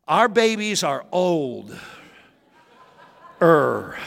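normal talking voice

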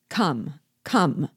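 two short, falling commands; impatient anger. A narrator's reading. General presses the hesitating planter, impatient and angry. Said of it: "in tones of impatience and anger".

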